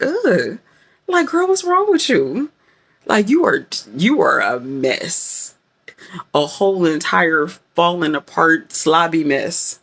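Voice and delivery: playfully dramatic dressing-down of yourself; dramatic self-scolding. Acted. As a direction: scold yourself like an exasperated friend, hammed up. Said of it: "I can be a little dramatic".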